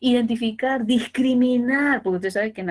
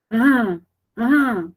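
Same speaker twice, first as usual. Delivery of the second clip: mock baby babble, loud; imitating a baby's ah ah; baby cooing; 8 dB louder than usual. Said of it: "la bebé hacía". (imitando a una bebé que balbucea)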